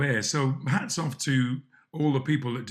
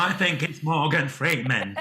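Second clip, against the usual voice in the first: imitating Alan Carr; slower than usual. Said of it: "Alan Carr thought".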